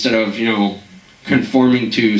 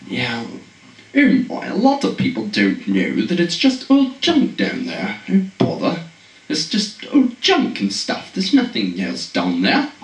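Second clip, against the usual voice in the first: mock British guy. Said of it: "he's like".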